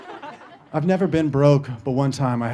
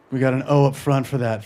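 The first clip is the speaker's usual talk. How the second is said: normal talking voice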